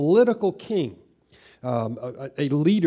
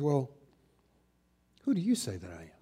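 very hushed and low, pausing after "Well"; soft, low-voiced question with a suspended beat; pregnant pause; 4 semitones lower, 9 dB quieter, faster than usual. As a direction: quietly put the question after a long, weighty pause. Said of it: "pausing for a moment".

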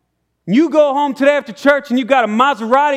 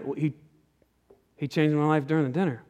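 low, soft and simple reply; quiet Zacchaeus; 8 semitones lower, 10 dB quieter, faster than usual. Retold or imitated. Zacchaeus telling the shocked townsman, low and soft. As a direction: answer gently, almost humbly.

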